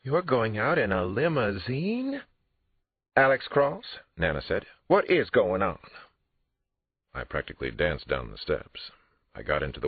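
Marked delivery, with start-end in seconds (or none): 0.0-2.2 put-on high, slow, awestruck child's voice; a daughter dazzled by the car; high breathless astonishment; amazed little girl. A narrator's reading. 3.2-5.8 put-on high, loud old woman's call; a grandmother calling out after her grandson; sharp old-lady exclaim; demanding grandmother. A narrator's reading.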